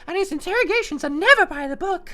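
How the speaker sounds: put-on high, bright-eyed girlish voice, loud and quick; high anime girl voice; anime schoolgirl voice. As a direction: play the sleepy student heroine in a high cute voice. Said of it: "I think you've got the accent wrong".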